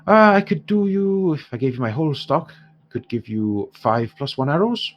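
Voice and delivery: lightly Irish dwarf trader, lifted openings; haggling dwarf shopkeeper. Acted. Dwarf quotes heroes his whole stock, lightly voiced and protesting. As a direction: haggle as a put-upon dwarf.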